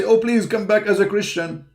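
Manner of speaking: high mock-begging voice; high, hammed-up mock plea; mock pleading critic. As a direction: ape them pleading, pitched well up. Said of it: "they say".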